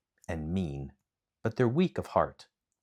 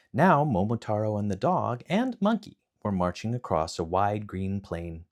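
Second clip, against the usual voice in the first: plain reading voice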